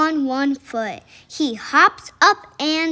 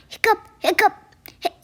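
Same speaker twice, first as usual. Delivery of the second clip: hiccuping; 5 semitones higher, much faster than usual. Acted. Pete still has hiccups.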